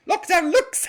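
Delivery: high, loud segment fanfare with a laugh; show announcement. Acted. Host launches the lockdown looks segment, high and loud with a cackle.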